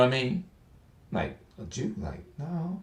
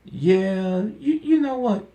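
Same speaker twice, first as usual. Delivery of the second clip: much higher, squeaky mock surprise; surprised realization; 11 semitones higher than usual. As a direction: gasp it out high as the penny drops. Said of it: "I'm sitting up there like".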